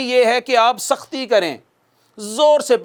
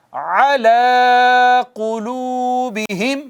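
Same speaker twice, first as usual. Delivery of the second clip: slow recitation; 2 semitones higher, much slower than usual. Shown as a demonstration. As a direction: recite slowly for students to repeat. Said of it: "پڑھیے گا میرے بعد".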